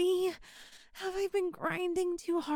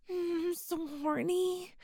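ordinary talk, nothing marked

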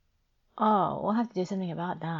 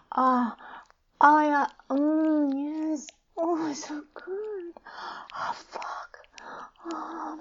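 high, loud moans falling into gasped whispers; breathless, overwhelmed high voice; acted climax; 8 semitones higher, 8 dB louder than usual. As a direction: peak in bursts, voice giving way between them. Acted climax in intimate role-play.

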